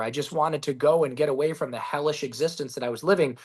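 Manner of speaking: ordinary talk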